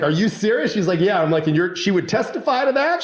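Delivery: high, incredulous questioning; retold disbelief. Lawyer to client about surprise evidence, mock-astonished. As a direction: pitch jumps up as you check you heard right.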